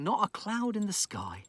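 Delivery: plain reading voice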